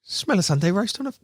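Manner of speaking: high-pitched imitation of a mate's catchphrase; Tommy impression. Imitating a mate's running nose joke, teasing. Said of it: "I can't say it, but he goes".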